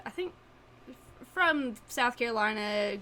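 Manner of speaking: ordinary talk, nothing marked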